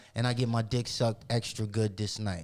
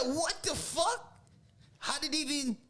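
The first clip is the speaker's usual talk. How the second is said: shocked disbelief; 3 dB quieter than usual. Retold or imitated. Told an invite to go around back, squeaky high shock. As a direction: stretch out the what in shocked disbelief.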